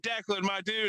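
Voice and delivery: a hint of an old friend's welcome; imitating Lump. Old regular greeting him in front of the room, friendly.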